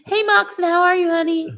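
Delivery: high, loud sugary hello; high sweet hello to a viewer; sweet greeting. Real, not acted.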